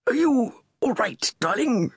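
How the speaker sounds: checking on his coughing wife; worried Charles. A narrator's reading. Charles to coughing Camilla, mildly worried. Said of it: "turned worried eyes on her".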